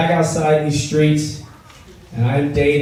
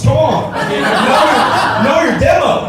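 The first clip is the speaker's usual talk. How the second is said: playful shout; 8 semitones higher than usual. Real, not acted. Chiding audience playfully.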